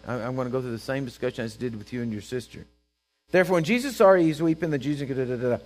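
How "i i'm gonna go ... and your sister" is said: low, soft, quick aside; mock weary attitude. Preacher voicing Jesus facing the sister's same discussion, low weary aside. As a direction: lightly voice someone tired of repeating himself. He may have had the attitude.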